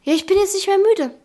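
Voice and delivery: höher, trotzig im Halbschlaf; genervt nachgemacht. Retold or imitated. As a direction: den genervten Schlaftrunkenen nachäffen. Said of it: "Sagt richtig genervt auch". (genervt nachgeäfft)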